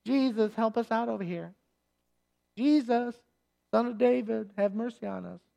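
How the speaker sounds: soft, meek little request; a half-hearted ask for mercy; timid mock plea. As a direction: say it politely, almost under your breath. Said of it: "So this isn't".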